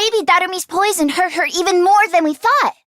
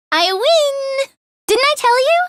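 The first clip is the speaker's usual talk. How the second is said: excited; 7 semitones higher than usual. Acted. Winning a race.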